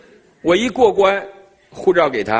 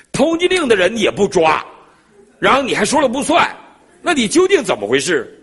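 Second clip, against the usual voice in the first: higher and louder, exasperated retort; indignant; 10 semitones higher, 5 dB louder than usual. Retold or imitated. Traveler demanding answers from the airport officer, exasperated and loud. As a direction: hammer the officer with outrage.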